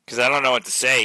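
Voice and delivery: ordinary delivery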